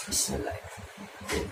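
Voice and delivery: quick whispered basmalah; whispered prayer. Real, not acted. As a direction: breathe the prayer under your breath. Whispered prayer before eating.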